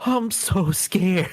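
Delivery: high, slow whimper of a Shaggy voice; scared Shaggy. Retold or imitated. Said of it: "He's like".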